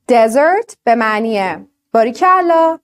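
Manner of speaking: دزرت said slowly, stress on the first syllable; slow demo. Shown as a demonstration. Teacher slowly models دزرت for her students. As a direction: spell out دزرت slowly for the class. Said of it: "بیابونه یا دسر؟".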